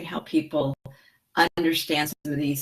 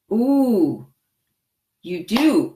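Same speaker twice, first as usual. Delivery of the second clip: delighted surprise; 5 semitones higher, 6 dB louder, much slower than usual. Real, not acted. Reacts to Mary's comment.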